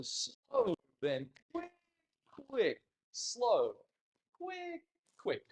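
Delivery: "quick" stretched out in a high dance count; slow quicks. Shown as a demonstration. Teacher counting the foxtrot steps, quicks made slow. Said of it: "make our quicks slow".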